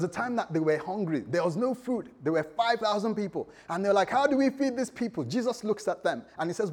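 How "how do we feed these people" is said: much higher, anxious question; hammed-up alarm; mock worry. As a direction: disciples at a loss before the crowd, mock worry. Disciples facing hungry crowd.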